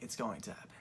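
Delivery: hushed secret whisper to himself; inner voice. Retold or imitated. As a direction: whisper it like a private certainty. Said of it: "In my mind, I was like".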